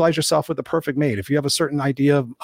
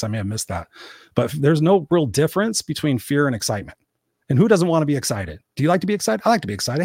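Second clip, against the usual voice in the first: ordinary talk, nothing marked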